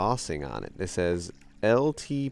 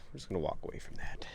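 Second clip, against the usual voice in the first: fast hushed whisper; 2 semitones lower, 10 dB quieter, faster than usual. Real, not acted. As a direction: slip away quietly, spooked. Spooked by creepy find.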